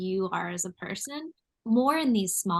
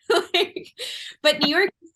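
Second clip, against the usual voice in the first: laughing into the next line, a bit faster; 9 semitones higher, 5 dB louder than usual. Real, not acted. Host still laughing at her own photo joke. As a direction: still chuckling while picking the thread back up. Laughing after a funny story.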